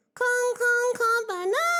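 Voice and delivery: very high, loud cutesy greeting; squeaky greeting. Acted. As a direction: squeal the catchphrase out to chat. Cutesy catchphrase greeting to chat.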